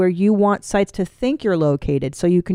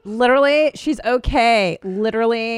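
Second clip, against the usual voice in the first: exaggerated girly vowels; mimicking young women. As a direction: stretch the words like affected girl talk. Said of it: "That is what they sound like".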